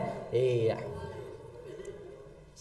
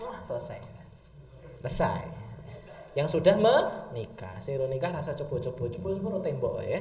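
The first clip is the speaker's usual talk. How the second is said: usual speaking voice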